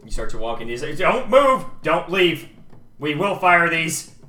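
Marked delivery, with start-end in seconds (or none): 1.0-4.2 loud, raised guard's command; firm warning, slowing at the end; threatening order. Acted. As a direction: shout a warning at gunpoint. Guard threatens with nocked arrows.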